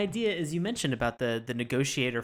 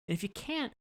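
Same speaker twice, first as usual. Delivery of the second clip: high-pitched, softer squeak of advice; comic high-voiced suggestion; joking aside; 12 semitones higher, 7 dB quieter than usual. Real, not acted. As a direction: go up high and small for the punchline. Joking punchline to his lesson.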